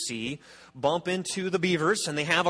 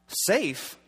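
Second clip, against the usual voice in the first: a lightly voiced Mister Beaver, incredulous; a hint of a gruff beaver repeating safe?; 4 semitones higher than usual. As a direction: toss the word back as if it's absurd. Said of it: "says Mister Beaver".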